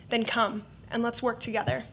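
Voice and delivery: normal talking voice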